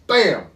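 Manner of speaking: sudden loud, high "bam"; imitated gunshot. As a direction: burst it out like a rifle shot.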